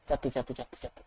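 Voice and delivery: low, very soft repeated mutter; hushed mutter. Acted. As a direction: mutter it under your breath. Muttering after killing in game. (പതിഞ്ഞ സ്വരത്തിൽ)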